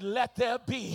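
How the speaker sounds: loud declaration of God's word; shouted command. Acted. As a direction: call it out with full force. Preacher repeats God's command, shouting.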